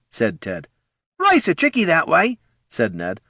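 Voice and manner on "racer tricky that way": high, put-on ghoul voice; ghoul's remark. Ned chimes in after Ted, high ghoul voice. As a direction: pipe up in a high character voice.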